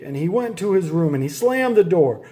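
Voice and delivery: ordinary delivery, nothing marked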